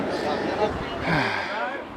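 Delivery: long, soft sigh. Real, not acted. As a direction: let out a long quiet sigh. Weary wait at the repair.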